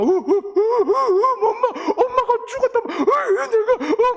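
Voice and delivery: high, loud mock sobbing half laughing; hammed-up wailing. Preacher retells dream, hamming up sobs for mom. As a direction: wail like a boy who thought his mom died, laughing through it. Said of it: "막 이러고 막 울었어요".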